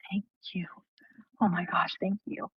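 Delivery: breathless whisper of gratitude; barely voiced, awed thank you.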